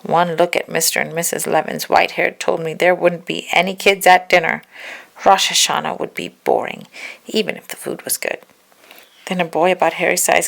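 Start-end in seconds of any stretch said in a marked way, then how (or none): none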